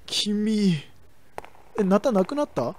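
ordinary talk, nothing marked

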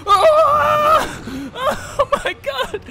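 high screaming with panicked laughter; terrified scream. Real, not acted. Screaming as a monster jumps her, laughing. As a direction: shriek as something lunges.

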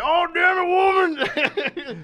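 high, loud mock-Southern outrage; hammed-up angry Southern husband; heavy Southern accent. Husband confronting his wife, high heavy mock Southern accent. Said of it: "with a very heavy Southern accent".

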